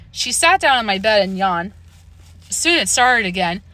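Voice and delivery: usual speaking voice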